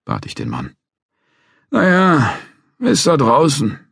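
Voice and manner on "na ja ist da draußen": put-on high, slow, hesitant old man; an unsure old guard hedging; hesitant old watchman. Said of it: "meinte Mister Barry zögerlich".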